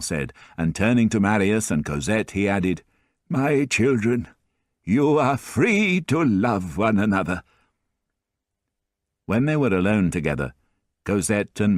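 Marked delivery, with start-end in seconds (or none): 3.3-7.4 a higher, slow voice for the doting grandfather; higher old grandfather voice, tender and teasing; fond old grandfather. A narrator's reading. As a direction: play the kindly old man, pleased with himself, slowly. Grandfather blesses the couple.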